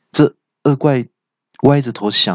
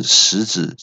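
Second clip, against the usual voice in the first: plain reading voice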